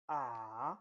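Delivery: Mandarin third tone on a long AH, falling then climbing. Shown as a demonstration. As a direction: drop AH down and swing it back up. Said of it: "You fall and rise".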